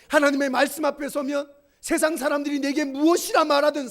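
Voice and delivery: loud, high, fervent pulpit preaching; full-voiced sermon climax; preaching at full force. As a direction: drive each line home to the congregation.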